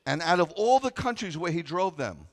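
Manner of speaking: loud, high preaching of the verse; full-force pulpit reading; preaching scripture. Acted. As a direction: proclaim it to the congregation.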